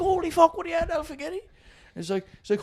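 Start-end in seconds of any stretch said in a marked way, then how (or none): 0.0-1.4 mock surprised greeting. Retold bar owner's surprised greeting.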